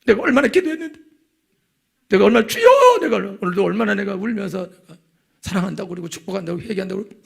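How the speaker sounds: high, loud, wailing complaint; aggrieved wife's outburst. Retold or imitated. Wife crying out over her drunk husband, high and loud. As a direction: pour out her wounded pride at full voice. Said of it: "하고".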